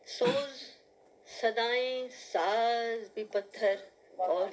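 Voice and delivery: halting free verse with long pauses; dramatic Urdu nazm recital; poetry recitation. Acted. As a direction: set each short phrase apart, drawing out key words. Recites her poem.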